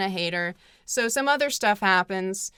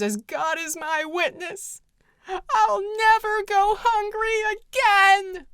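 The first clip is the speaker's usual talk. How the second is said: dramatic Scarlett line; 10 semitones higher, 3 dB louder than usual. Retold or imitated. Scarlett swearing to God, in a hammed-up high vow. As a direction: overact the famous vow to the heavens. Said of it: "there's a famous line in the movie where she goes".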